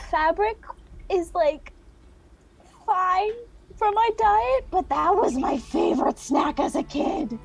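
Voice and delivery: eager little moth; moth character voice. Acted.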